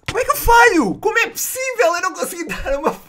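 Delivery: very high, loud, squealed disbelief; exasperated shriek breaking into laughter; incredulous outcry. Real, not acted. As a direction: wail in comic despair at your aim. Keeps missing his shots.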